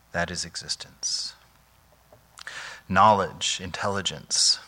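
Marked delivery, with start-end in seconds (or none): 0.1-1.3 whispered. Real, not acted. Hushed climax of reflection.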